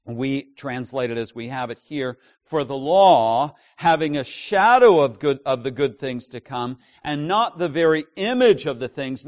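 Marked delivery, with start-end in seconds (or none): 2.4-9.3 loud, deliberate reading of the verse; emphatic scripture reading. Acted. Preacher reading the Hebrews verse aloud, loud and emphatic. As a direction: proclaim the verse to the congregation.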